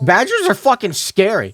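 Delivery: freaked out by the badger; scared horror game streamer. Real, not acted.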